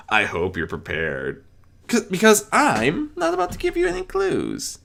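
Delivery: high, theatrical trickster host; gloating game show host; Discord voice. A narrator's reading.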